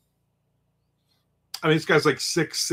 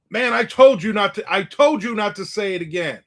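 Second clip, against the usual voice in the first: louder and higher, I warned you; mock angry scold; 7 semitones higher, 4 dB louder than usual. Big guy scolds the pinned pest, raised and angry. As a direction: scold him while pressing down.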